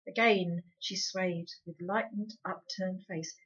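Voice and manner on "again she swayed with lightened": ordinary delivery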